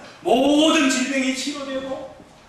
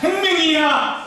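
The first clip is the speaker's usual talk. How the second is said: call for repetition; 5 semitones higher, 3 dB louder, slower than usual. Shown as a demonstration. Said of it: "한번 따라해 봅시다".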